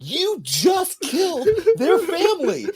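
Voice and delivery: high, loud laughing rant; real laughing disbelief, slow and loud; laughing outrage. Real, not acted. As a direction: yell it through laughter, incredulous.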